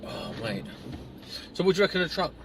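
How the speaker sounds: normal talking voice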